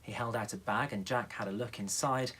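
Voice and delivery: usual speaking voice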